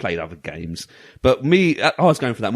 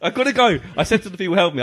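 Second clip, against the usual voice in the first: ordinary delivery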